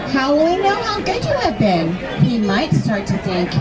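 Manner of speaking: usual speaking voice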